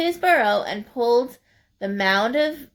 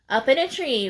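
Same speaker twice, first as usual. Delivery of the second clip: usual speaking voice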